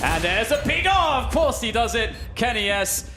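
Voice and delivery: explode, then savour the save; excited reaction. Real, not acted. Caster reacts to clutch save.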